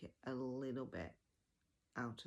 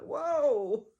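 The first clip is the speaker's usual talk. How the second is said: a much higher, much louder mock gasp of surprise; hammed-up shocked whoa; startled whoa; 12 semitones higher, 16 dB louder than usual. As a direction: jolt as if suddenly scolded. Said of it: "and I was like".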